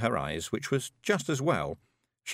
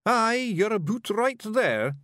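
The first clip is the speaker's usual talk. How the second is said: high, slow Scots voice; hearty high-pitched Feegle at a bedside; Scottish Feegle; 12 semitones higher, 4 dB louder, slower than usual. A narrator's reading.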